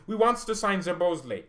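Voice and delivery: high, loud hammed-up German accent; a goofy German-accented coach; mock German Nagelsmann. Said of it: "That's how Julian Nagelsmann sounds".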